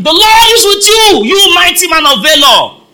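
high, loud angelic announcement; booming proclamation. Acted. Angel of the Lord hailing Gideon, booming proclamation. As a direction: proclaim it at full force.

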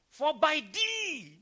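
loud, high, drawn-out exclamation; emphatic proclamation. Real, not acted. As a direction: proclaim it slowly and loudly to the crowd. Exclaims verse at full force.

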